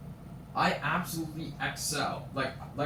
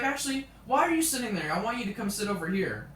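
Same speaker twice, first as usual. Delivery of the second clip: high, louder imitated order; mock demanding teacher; 6 semitones higher, 3 dB louder than usual. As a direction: pester the student in a raised teacher voice. Imitates teacher ordering student to move.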